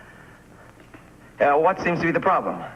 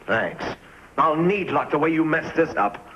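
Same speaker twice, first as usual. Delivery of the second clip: usual speaking voice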